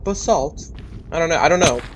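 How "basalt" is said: "Basalt?" as "puh-SALT", questioning, pitched up; trying out pronunciation. Shown as a demonstration. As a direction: test "Basalt?" aloud, unsure. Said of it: "I don't know what accent I'm giving it".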